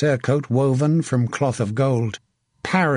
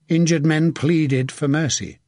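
ordinary delivery, nothing marked

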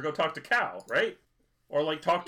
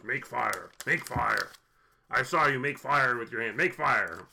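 desperate demand. Acted. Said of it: "Good Gabriel voice".

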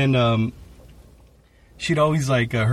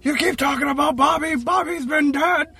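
put-on much higher, slowed, shouting old-man voice; snapping old man; 13 semitones higher than usual. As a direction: snap at her in exasperation. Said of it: "snapped at the old lady".

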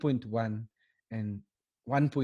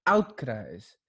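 ordinary talk

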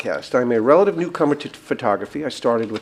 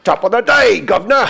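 loud, high, hammed-up Cockney; 6 semitones higher, 6 dB louder than usual. Host mocking the Mary Poppins sweep, in broad fake Cockney. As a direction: belt out a chimney sweep's mock Cockney. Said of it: "it's like the chimney sweeps from".

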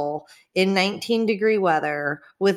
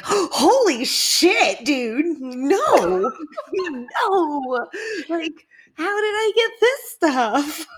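shocked disbelief; 8 semitones higher, slower than usual. Retold or imitated. Friend retelling her reaction to a herpes diagnosis, shocked. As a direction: gasp it out in disbelief.

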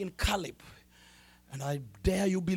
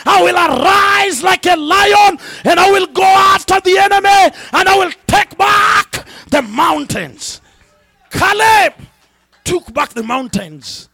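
roaring, much higher and louder preaching; preaching at full force; 10 semitones higher, 16 dB louder than usual. Acted. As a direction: shout it out like a revival call. Preacher roars a call to arms.

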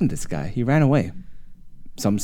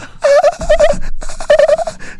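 loud, very high cackle; relentless mock giggle; imitated laughter; 6 dB louder than usual. All he still hears, loud high laughter. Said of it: "that's all he still hears".

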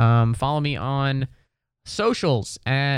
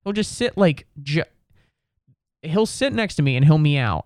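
normal talking voice

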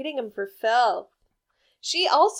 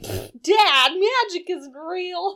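put-on scoffing kid; 7 semitones higher, slower than usual. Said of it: "his kid's like".

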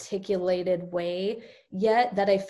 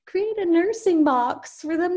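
mock perky advice; 9 semitones higher than usual. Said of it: "the Pinterest stuff where it's like".

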